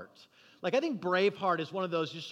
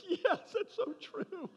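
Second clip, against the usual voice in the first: high-pitched mock agreement from the ranks; cheering soldiers; 12 semitones higher, 4 dB quieter than usual. Soldiers answering their leader's speech, comic high cheer. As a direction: imitate won-over men in a high strained voice. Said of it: "all the guys are like".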